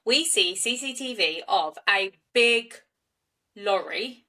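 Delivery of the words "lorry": usual speaking voice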